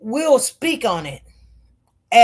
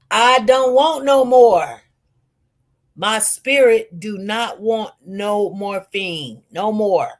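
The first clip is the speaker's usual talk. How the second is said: "I don't want no more" slow and insistent; measured, final emphasis on "no more"; firm refusal; 3 semitones lower than usual. Retold or imitated. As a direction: spell out "do not want no morphine" deliberately. Overrules mother, retold refusal.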